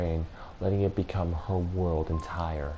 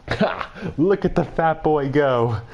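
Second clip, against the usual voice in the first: high, loud mocking laugh; barked laugh; 8 semitones higher, 7 dB louder, slower than usual. Old woman laughs at fleeing boy, loud and mocking. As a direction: bark a laugh at his retreat. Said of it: "barked out a single laugh".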